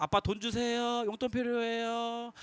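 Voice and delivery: 천천히 부드럽게 조르기; 애원하듯 천천히 늘여; 조르는 아이. Shown as a demonstration. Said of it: "그러한 마음을 가지고". (용돈을 달라고 조르는 아이, 천천히)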